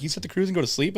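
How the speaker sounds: mock amazed friends asking; incredulous question. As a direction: ask it like you can't believe it. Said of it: "everyone's like".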